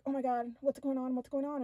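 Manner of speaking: reliving a loud, frantic reaction; freaking out. Retold or imitated. Narrator relives waking beside an alien, freaking out. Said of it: "And I'm freaking out".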